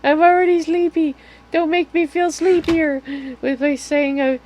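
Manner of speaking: high, loud mock complaint; playful loud protest. Real, not acted. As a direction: whine it at chat, loud and high. Playful protest at chat's teasing.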